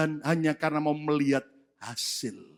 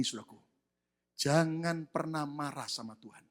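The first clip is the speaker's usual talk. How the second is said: normal talking voice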